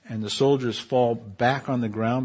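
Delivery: normal talking voice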